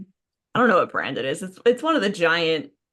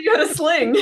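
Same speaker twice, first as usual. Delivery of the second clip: teasing exclamation; 10 semitones higher, 6 dB louder than usual. Retold or imitated. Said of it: "I was like".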